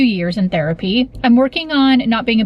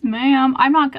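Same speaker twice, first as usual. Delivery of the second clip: usual speaking voice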